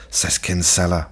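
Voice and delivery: ordinary talk, nothing marked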